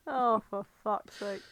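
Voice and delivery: ordinary talk, nothing marked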